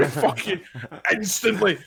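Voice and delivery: usual speaking voice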